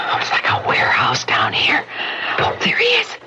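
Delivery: hushed, unvoiced whisper; whispering in a dark cellar.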